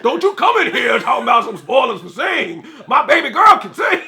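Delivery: loud shouting mama; elephant mom voice. Retold or imitated. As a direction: yell it like a fierce mother. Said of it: "sound like that elephant".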